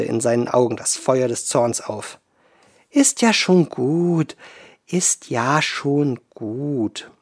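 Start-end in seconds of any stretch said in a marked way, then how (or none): 2.9-4.4 slow, gentle calming; soothing. Acted. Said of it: "beschwichtigte ihn die Wasserfrau". 4.9-7.1 soothing. Acted. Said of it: "beschwichtigte ihn die Wasserfrau".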